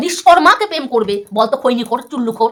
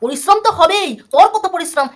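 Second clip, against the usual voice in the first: higher, scornful jab; sarcastic retort; 5 semitones higher than usual. Real, not acted. Vlogger mocking rival's hard-work claim, sarcastic and higher. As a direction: throw his words back at him.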